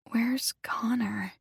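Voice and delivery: slow, raspy whisper. Acted. Zoe just woken in hospital asks for Connor, slow raspy whisper. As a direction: rasp it out, barely awake. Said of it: "said Zoe in a raspy voice".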